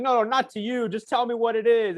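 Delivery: high, animated mock interruption; agitated interrupter. Host cutting off his guest's answer, retold as agitated. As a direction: imitate an agitated host who won't let the guest finish. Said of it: "kind of agitated".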